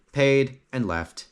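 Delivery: plain reading voice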